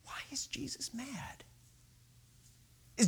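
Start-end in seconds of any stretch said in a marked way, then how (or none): 0.0-1.4 hushed, whispered question; wondering whisper. Retold or imitated. Preacher wondering at the passage, whispering. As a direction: whisper it as a private puzzle. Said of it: "And I wondered".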